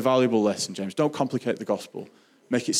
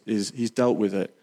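ordinary talk, nothing marked

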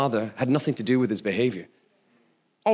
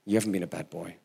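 gentle mother; 6 semitones lower, 6 dB quieter, faster than usual. Retold or imitated. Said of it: "She said".

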